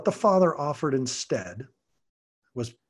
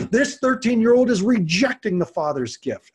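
emphatic; 7 semitones higher, 6 dB louder than usual. Real, not acted. Emphatic point building.

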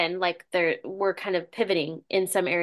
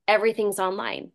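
ordinary delivery

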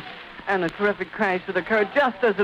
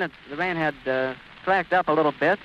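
ordinary talk

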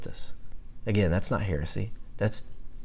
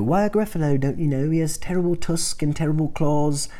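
high British storybook recital; British accent; 6 semitones higher than usual. Acted. Mouse describing the Gruffalo to animals, British accent. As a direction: read it like a British storyteller, pitched up. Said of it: "That's a pretty good British accent".

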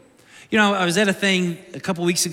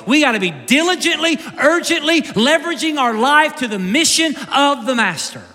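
raised, forceful sermon delivery; urgent preaching; 4 semitones higher, 3 dB louder than usual. Real, not acted. Preacher urging the congregation to work before night comes, urgent preaching. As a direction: drive it home with punched words.

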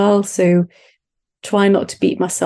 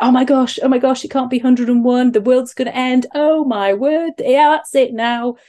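high, hammed-up mock panic; high-pitched silly voice; 5 semitones higher than usual. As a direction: play the drama queen over one gram. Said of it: "in a really high-pitched, silly voice".

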